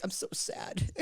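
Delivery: hushed, whispered mock sadness; barely voiced, put-on sorrow; mock sad emo. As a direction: say it very softly, imitating a sad friend. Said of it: "I was emo".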